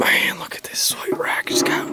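slow awed whisper. Real, not acted. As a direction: whisper in admiration. Admiring the antlers.

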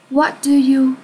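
ordinary talk

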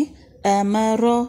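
normal talking voice

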